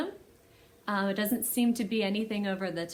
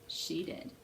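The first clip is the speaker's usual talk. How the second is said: whisper; 6 semitones lower, 10 dB quieter than usual. Pretend. Whispered answer to kids.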